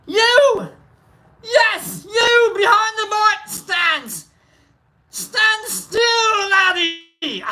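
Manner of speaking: high, hollering Scottish schoolmaster; Scottish accent. Acted. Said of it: "This is where I get my Scot".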